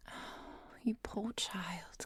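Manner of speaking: soft, slow pity; tender sympathy. Acted. Professor pitying the bitten child, tender sympathy. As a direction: breathe it out slowly, full of sympathy.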